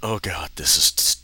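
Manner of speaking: usual speaking voice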